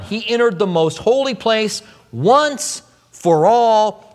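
"once" drawn out and punched, slow and weighty; stress on once. Shown as a demonstration. Preacher stresses to the congregation that Christ entered "once", slow and weighty. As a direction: lean hard on "once" and take your time. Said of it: "I put the emphasis on the word once".

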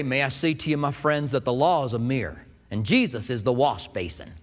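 put-on old country preacher, folksy and measured; J Vernon McGee. Pastor quoting the preacher he learned from, old country preacher imitation. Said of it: "that old country preacher kind of a guy".

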